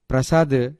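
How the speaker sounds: ordinary delivery, nothing marked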